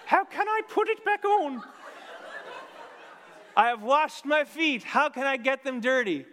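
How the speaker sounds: very high, loud woman's voice. Acted. Bride refusing to get up for her lover, high and loud. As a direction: whine it as the reluctant bride. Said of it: "And then the woman".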